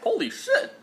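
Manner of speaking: sudden high exclamation of surprise; surprised. Real, not acted. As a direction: blurt it out, genuinely shocked. First taste of salted dip.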